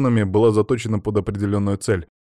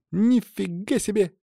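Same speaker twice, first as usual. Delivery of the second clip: high, drawn-out wonder; amazed gasp; 11 semitones higher than usual. A narrator's reading. Katya seeing what Stas carried off, awestruck. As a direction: stretch it out in high-pitched astonishment. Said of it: "ахнула Катя".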